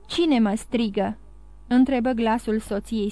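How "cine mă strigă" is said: ordinary delivery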